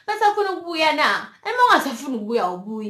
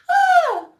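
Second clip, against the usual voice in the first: high, loud laugh breaking into a sob; a burst of half laughter, half tears; teary laugh-cry; 9 dB louder than usual. Real, not acted. Fighting tears in front of viewers, a high teary laugh. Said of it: "I don't wanna cry".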